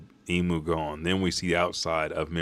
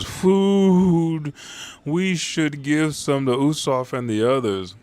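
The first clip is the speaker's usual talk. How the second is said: Luffy; 8 semitones higher, 3 dB louder, slower than usual. Retold or imitated. Voicing a drained, hungry Luffy.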